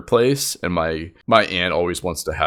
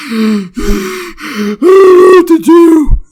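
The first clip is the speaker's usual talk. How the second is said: gasping, then a high loud wail; mock hysterical panic between gasps; hyperventilating; 13 dB louder, much slower than usual. Aunt over the spilled turkey, mock hyperventilating cry. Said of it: "Starts, like, hyperventilating".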